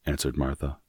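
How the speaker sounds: ordinary delivery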